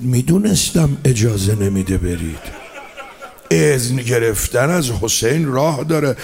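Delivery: a mother gently smiling at her sons' tears; Zaynab's knowing reply. Acted. Zaynab answers her sons, smiling.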